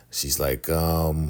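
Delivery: ordinary talk, nothing marked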